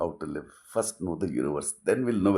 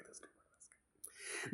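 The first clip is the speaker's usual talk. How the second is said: slow whisper; 26 dB quieter than usual. Shown as a demonstration. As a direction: breathe it out slowly in a whisper. Repeats the line, then whispers it.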